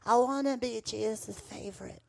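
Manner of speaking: put-on nine-year-old me. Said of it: "at, like, nine years old".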